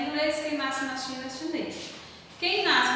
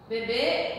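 slow "bebê" with a long, closed final Ê; stress on final syllable; 2 semitones lower than usual. Shown as a demonstration. Teacher setting up a contrast, slow final stress in "bebê". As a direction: stretch "bebê" onto its last syllable. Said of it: "Olha só o tom que mudou a palavra".

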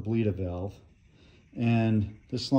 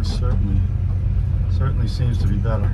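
in-car recording; 9 dB louder than usual. Real, not acted. Driving test, recorded in moving car.

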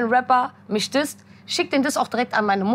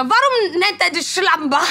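indignant mother; 6 semitones higher, 3 dB louder than usual. Retold or imitated. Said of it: "Sagt mal".

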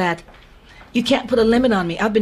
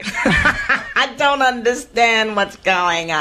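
laughing; 4 semitones higher, much slower than usual. Real, not acted. Laughs at losing voice idea.

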